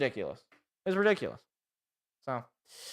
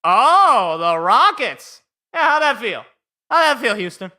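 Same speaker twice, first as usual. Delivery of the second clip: loud, higher taunting jeer at Houston; gloating; 4 semitones higher, 8 dB louder than usual. Real, not acted. Fan taunting Houston's team after lottery drop, loud gloating jeer. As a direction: rub it in, calling out to the rival team.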